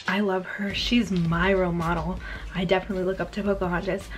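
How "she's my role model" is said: ordinary talk, nothing marked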